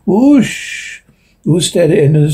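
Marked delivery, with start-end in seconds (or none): 0.0-1.0 high, breathy whoosh like a rushing wind; whoosh imitation. A narrator's reading. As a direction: mimic something rushing past, pitched up. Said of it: "another sound in his command".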